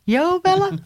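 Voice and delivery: "Yo Bella" swooping up high; playful high voice. Shown as a demonstration. Host showing co-host the name, playfully high.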